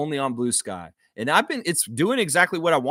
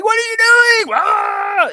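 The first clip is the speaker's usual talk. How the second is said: high, loud imitated yelling at the ref; mock frantic yell; 10 dB louder, slower than usual. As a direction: blow up at the ref like Scotty. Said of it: "Scotty yelling".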